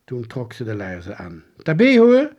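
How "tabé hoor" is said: high, loud singsong farewell; ringing girl's send-off; called goodbye. A narrator's reading. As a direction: call out a cheery parting. Monica calls goodbye.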